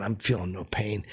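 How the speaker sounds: ordinary delivery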